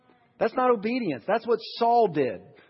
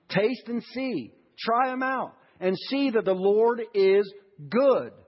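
slow emphatic preaching; 3 semitones higher, slower than usual. Shown as a demonstration. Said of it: "Just obey Him".

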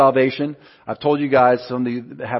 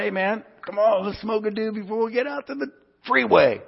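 higher, laid-back stoner pitch to a coworker; pitched up, mock stoner invite; stoner buddy voice; 8 semitones higher than usual. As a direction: play your younger stoned self, higher and loose. Retelling his stoner invitation.

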